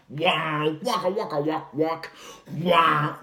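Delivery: mouthed dubstep wobble; imitating a synth wobble. Shown as a demonstration. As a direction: wah waka synth noises. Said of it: "like a dubstep synthesizer".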